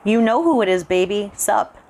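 put-on a smug kid gloating into the headset; smug taunt. A narrator's reading. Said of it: "the smug little bastard".

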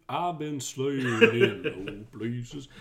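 slow, drawn-out words breaking into laughter; laughing joke. Pretend. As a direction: draw it out and laugh through it. Joking echo, laughing as they speak.

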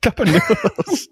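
high, slow, silly fake British; mock British accent. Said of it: "I don't think that's British".

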